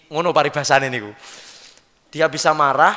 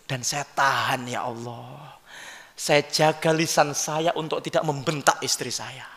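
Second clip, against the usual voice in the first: humble husband; 2 semitones lower, 6 dB quieter than usual. Acted. Husband telling God he held his tongue, humble. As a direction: answer softly and submissively.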